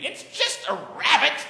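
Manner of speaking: a laughing, half-hearted try at a high posh British knight, slowed down; mock British bravado, said through a laugh, the speaker admitting he can't do the accent; British knight, a light, giggly imitation; attempted British accent. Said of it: "I can't do the British accent".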